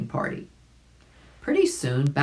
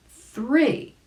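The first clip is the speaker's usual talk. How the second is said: excited; 10 semitones higher than usual. Acted. Billy Mink counts for the dive.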